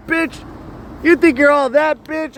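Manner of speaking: high-pitched mock yell at a rival; over-the-top taunting voice, pitched high; acted yelling. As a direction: ham it up like a trash-talking heckler. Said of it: "let me do one more piece of acting".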